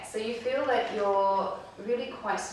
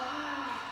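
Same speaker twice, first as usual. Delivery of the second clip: demo exhale sigh; 6 semitones higher than usual. Shown as a demonstration. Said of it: "the breath out".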